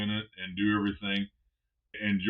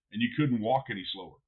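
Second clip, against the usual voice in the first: ordinary talk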